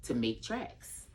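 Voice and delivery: slow, drawn-out storybook reading; reading to a toddler. Acted. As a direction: stretch it out for a toddler. Reads picture book aloud.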